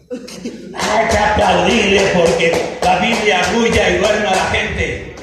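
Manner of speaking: loud, driving sermon delivery; fiery preaching. Real, not acted. Pastor rebukes the flock for neglecting the Bible, fiery preaching. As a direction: preach it hard to the congregation.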